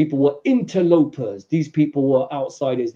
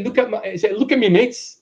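put-on London accent; 7 semitones higher than usual. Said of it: "Not a London one".

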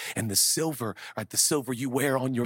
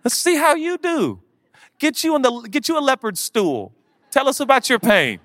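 sarcastic challenge; 13 semitones higher, 8 dB louder than usual. Retold or imitated. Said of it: "And I'm like".

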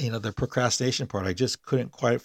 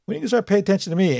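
high, fast pet's demanding voice; cat voice; 10 semitones higher than usual. Pretend. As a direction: voice the cat wanting attention. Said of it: "Artie was like".

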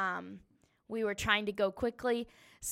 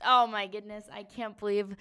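feisty; 7 dB louder than usual. Retold or imitated. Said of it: "a little feisty".

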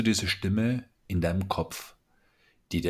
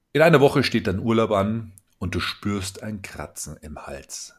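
normal talking voice

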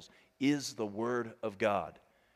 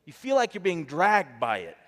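repeated louder; 5 semitones higher, 8 dB louder than usual. Shown as a demonstration. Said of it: "Come on now".

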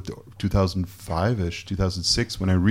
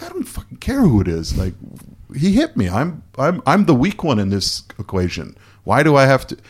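indignant outburst; 6 semitones higher than usual. Retold or imitated. Retold furious reply to friend.

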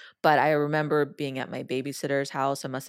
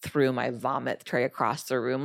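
usual speaking voice

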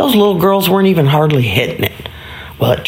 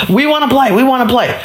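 put-on higher, eager childlike plea; eager little boys; 7 semitones higher than usual. Boys answer the super about playing, eager high plea. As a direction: chime in like kids wanting a turn. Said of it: "Do you guys wanna play?".

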